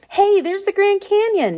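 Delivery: high, loud excited shout; excited yell. A narrator's reading. Boy spotting the Grand Canyon from the balloon, shouting excitedly. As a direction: yell it out thrilled at the sight. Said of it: "Josh yelled".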